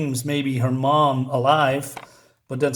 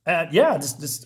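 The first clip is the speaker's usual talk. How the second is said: usual speaking voice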